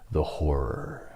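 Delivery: slow, hushed and drawn out; the horror, the horror, softly and slowly; dying whisper of horror. Retold or imitated. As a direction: breathe it like a dying man. Kurtz's famous dying line.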